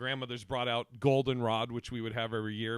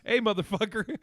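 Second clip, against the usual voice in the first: mock threat, laughing; 7 semitones higher, 5 dB louder than usual. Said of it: "he's like".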